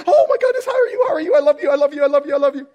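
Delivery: very high, loud, gushing hello; gushing dog-like greeting. Retold or imitated. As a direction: squeal it like an overjoyed puppy. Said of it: "I'm like".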